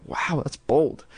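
plain reading voice

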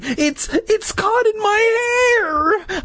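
very high shrieking wail; hammed-up tearful screech; crying out. A narrator's reading. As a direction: squeal it, stretching the end out. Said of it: "I cried".

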